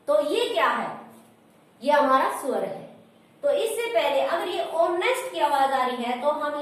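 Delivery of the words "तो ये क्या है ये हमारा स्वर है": normal talking voice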